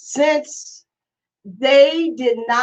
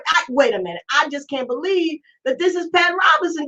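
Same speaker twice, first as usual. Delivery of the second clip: usual speaking voice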